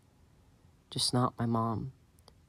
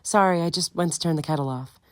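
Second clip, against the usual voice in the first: lightly voiced mother, higher and a touch out of breath; mother back from the kettle, mildly breathless; slightly breathless mother; 8 semitones higher, 5 dB louder than usual. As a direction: hurry in apologetically. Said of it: "sounding slightly breathless".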